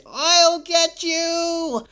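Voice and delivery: high, loud, drawn-out cartoon yell; villain's vengeful cry. Villain hit by the ball, vengeful drawn-out yell. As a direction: stretch out the threat in a high shout.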